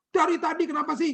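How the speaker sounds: imitating a very high, exasperated snap; mock indignant squeal; angry retort. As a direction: fire back high-pitched, as if fed up. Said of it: "Uh, dia marah".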